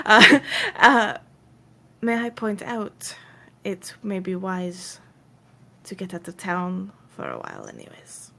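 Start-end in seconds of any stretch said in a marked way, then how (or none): 2.0-8.3 low, hushed, measured advice; Mayfair. Acted. Mayfair advising the party to leave town, low and hushed. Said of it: "Mayfair kinda steps forward and goes".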